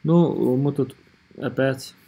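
ordinary talk

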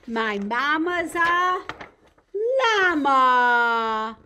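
slow, loud, drawn-out read-aloud; story reading to children. A narrator's reading. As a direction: land the last word big for little listeners. Ends a picture book reading.

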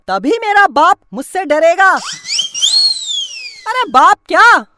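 ordinary delivery

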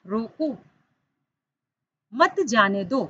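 "रोको", long stop, then "मत जाने दो" together; pause after रोको. Shown as a demonstration. Teacher showing pupils how a comma changes meaning, pause after रोको. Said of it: "अल्प विराम लगा है".

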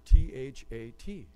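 T H A T, each letter slow and separate; spelled out letter by letter. Shown as a demonstration. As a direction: spell out T H A T one letter at a time. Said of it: "here's the answer".

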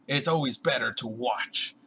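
ordinary talk

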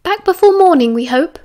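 high call from the hallway. A narrator's reading. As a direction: call back loudly, then let it drop. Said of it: "she called from the hallway".

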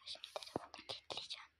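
barely audible, quick whisper; secretive whisper. Real, not acted. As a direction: whisper the plan so your victim can't hear. Prankster secretly plans next trick. (gizlice, hızlıca fısıldayarak)

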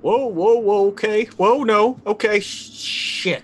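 high, loud alarmed whoas; startled panic. Real, not acted. Player panicking as the body starts convulsing, loud and high. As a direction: yelp in sudden fright.

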